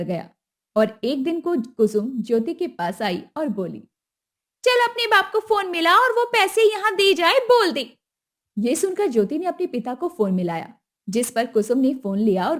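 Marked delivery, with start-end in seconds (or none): 4.6-8.0 higher, pushy demand; demanding mother-in-law. Acted. Kusum demanding the dowry at the wedding, high and pushy. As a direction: ask for the money like it's owed.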